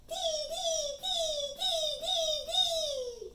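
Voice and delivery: high, held, chiming dees; high repeated bird call on one note; chickadee call. A narrator's reading. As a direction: chime in like a chickadee.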